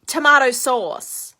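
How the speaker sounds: loud, slow, blunt demand; mock a rude customer yelling, dragging out both words; retold yell. American lady yelling at the waitress, mock demanding yell. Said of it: "she's literally just yelling at me".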